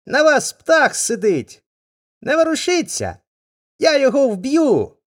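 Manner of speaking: high, loud, eager cries; a boy keen to hit the bird; excited boy shouting. Acted. Boy warning his father to keep still, high and eager.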